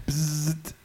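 buzzer sound for wrong answer; a quick mouth buzz; bug zapper zap. Acted.

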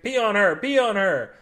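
screaming king. Acted. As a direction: yell it out in pain. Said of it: "he screamed".

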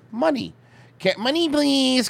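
mock pleading voice, slow and high; begging. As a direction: beg for money like a needy fan. Pleading for money quote.

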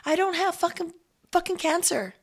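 retold high-pitched joyful blurt; reenacted giddy outburst at normal volume; elated announcement. Retelling blurting good news to driver.

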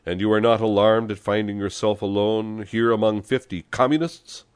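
loud, high, climbing challenge; challenging question. A narrator's reading. Communist leader to his lone liberal passenger, challenging. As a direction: press him, voice climbing at the end.

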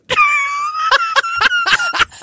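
high squealing laugh; shrieking laugh. Real, not acted. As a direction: burst into shrill laughter. Loud laughter after quote.

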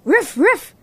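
a high, rising woof woof; playful dog bark. Acted. Dog barks happily at the goat. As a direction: bark like a happy little dog.

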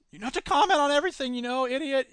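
lightly voiced sidekick teasing; heckling sidekick voice. Bob voice heckles the host.

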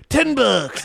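very high cartoon pitchman catchphrase; Mister Allen. Quoting a commercial's price gag, high cartoon salesman. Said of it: "yeah, he'd be like".

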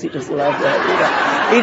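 slow, laughing through the words; slow, fond chuckle. Real, not acted. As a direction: chuckle as you say it. Fond laugh about husband.

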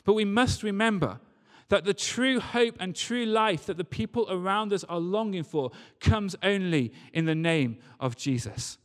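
ordinary delivery

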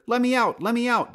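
trapped donkey shouting to be freed; loud complaint. Acted. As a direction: yell to be let out of the pen. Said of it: "Dusty complained loudly".

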